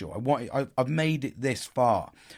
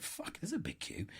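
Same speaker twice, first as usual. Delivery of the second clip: whispered under his breath; a soft, dismayed whisper; 7 semitones higher, 15 dB quieter than usual. Retold or imitated. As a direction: hiss it so nobody in the queue hears. Aside about the long queue.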